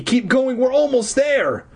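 high, urgent push onward; high urgent plea to keep going; urgent urging. A narrator's reading. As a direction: urge your brother on, pitched high. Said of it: "I urged Eddie".

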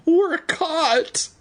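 put-on squeaky scared kid; high panicked boy. A narrator's reading. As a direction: squeal it in fright.

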